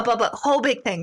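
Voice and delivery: ordinary delivery, nothing marked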